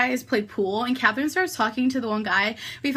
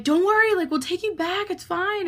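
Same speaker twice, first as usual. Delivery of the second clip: higher, lightly voiced guys coaxing; mock reassuring guys; 8 semitones higher than usual. Guys reassuring the girls about the ride, mock high coaxing. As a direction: imitate the guys smoothing it over. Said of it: "And they're like".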